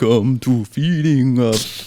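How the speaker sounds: slow, drawn-out count voice; Dracula voice. Acted. Host opens the show in a slow Dracula voice. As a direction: stretch each word like a vampire host. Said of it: "now I'm doing the Dracula".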